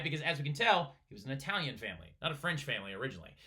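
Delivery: ordinary delivery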